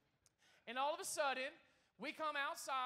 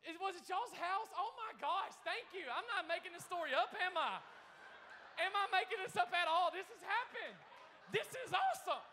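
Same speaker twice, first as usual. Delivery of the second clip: loud, higher, delighted disbelief; real excited surprise; 4 semitones higher, 6 dB louder than usual. Real, not acted. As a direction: blurt it out, thrilled by the coincidence. Discovers the neighbors are in the audience.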